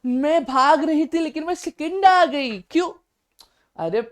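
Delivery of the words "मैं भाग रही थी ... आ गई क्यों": lightly mocking, high-pitched girl's voice; daddy's little princess. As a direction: pitch it up like daddy's princess announcing her result. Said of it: "भाग रही थी ना पप्पा की परी".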